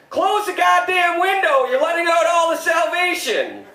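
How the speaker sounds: high, slow furious shout; God's dad roaring at his son to shut the window; angry dad yelling. Acted. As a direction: bellow like an outraged father. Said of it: "God's dad goes".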